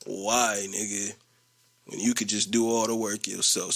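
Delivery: low, unbothered mimicry; mocking coworker. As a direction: drop the voice and imitate someone shrugging off work. Mocking coworker's imagined answer.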